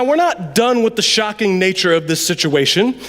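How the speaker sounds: usual speaking voice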